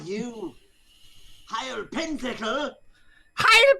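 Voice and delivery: very high, rising disbelief; startled recognition. Acted. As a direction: gasp out the name. Character recognizes Santa.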